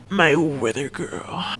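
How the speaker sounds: low, slow creepy flirting; creepy flirty. Retold or imitated. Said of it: "He said it, like, so creepy and flirty".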